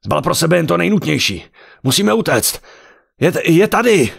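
high, loud urgent yelling; panicked shout. A narrator's reading. Husband bursting in to warn his wife, panicked shout. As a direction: scream the warning as the enemy closes in. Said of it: "zařval".

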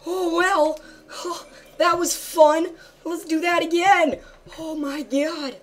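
high, loud giddy glee; breathless high thrill. Real, not acted. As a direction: squeal with delight. Thrilled after close call.

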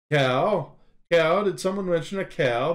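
lightly voiced girlish ox; mild girlish voice. The ox calling about the cow, mild girlish. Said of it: "in a mild, girlish voice".

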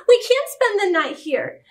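high-pitched dismayed protest; crying out in protest. A narrator's reading. Tifam protesting a night at the mission, crying out. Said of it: "cried Tifam".

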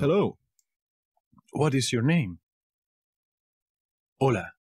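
normal talking voice

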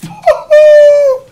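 loud, high laughing shout; piercing burst of laughter; shrieking laugh. Real, not acted. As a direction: howl with laughter.

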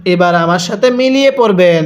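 ordinary delivery

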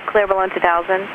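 ordinary talk, nothing marked

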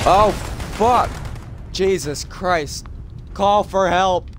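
high, loud terrified yelling; startled shrieks, full fright; panicked scream. Real, not acted. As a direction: scream in real panic as you get hit. Ambushed in game, screaming.

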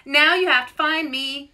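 high, loud teasing yell. A narrator's reading. Louise darting into bushes, teasing yell. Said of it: "yelled Louise".

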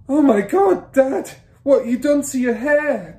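very loud, high appalled shout; son's shout. A narrator's reading. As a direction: cry out at Dad in shock. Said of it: "the son shouted".

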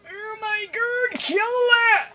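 high, drawn-out squeal; high-pitched alarmed whine; mock panic. Panicking at an enemy in game.

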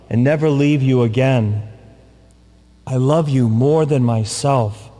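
normal talking voice

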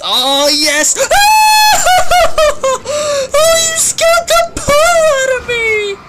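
high startled shout breaking into laughter; startled laughing shriek. Real, not acted. Scared by grenade pickup.

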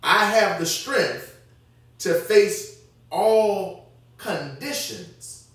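drawn out very slowly, word by word; slow emphatic reading. Shown as a demonstration. Preacher rereads the Good News verse to his church, very slow and weighty. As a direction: lean on every word of the verse.

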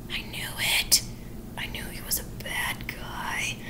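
a secretive, vindicated whisper; breathy murmured accusation. Retold or imitated. Toby discovers Sweeney.

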